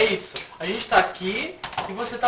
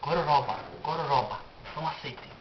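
low grumble; 11 semitones lower, 13 dB quieter than usual. Real, not acted. Grumbling aside after the fight.

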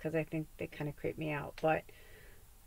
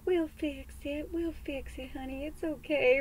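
[name] soothing; 6 semitones higher than usual. Retold or imitated. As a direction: coo it gently like a fond southern friend. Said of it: "[name]'s like".

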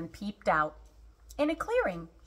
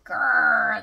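loud, rolling growl; tiger growl; 6 dB louder than usual. A narrator's reading. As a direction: growl loudly like an angry tiger. Tigers growl as they run.

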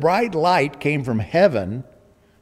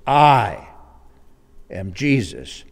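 "I" loud and held, then "am Jesus" dropping; stress on I; 3 semitones lower, 5 dB louder, slower than usual. Shown as a demonstration. Preacher showing the Greek emphasis, "I" loud and drawn out. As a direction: punch "I" hard, let the rest fall. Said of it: "and that's the emphasis".

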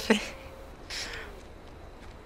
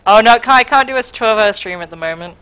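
ordinary talk

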